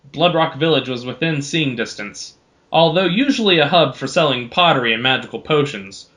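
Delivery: ordinary delivery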